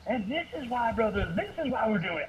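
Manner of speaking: high, fervent pulpit appeal; full-on preacher. Shown as a demonstration. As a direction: amp it up like a preacher. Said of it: "going to the full on".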